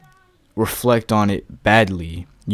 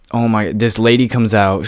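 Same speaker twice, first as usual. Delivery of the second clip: ordinary delivery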